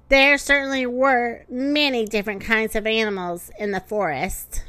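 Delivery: ordinary delivery, nothing marked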